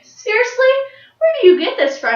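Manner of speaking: loud, high-pitched retold disbelief; incredulous. Astonished older rider questioning the fearless child, acted incredulity. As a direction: demand it in exaggerated amazement.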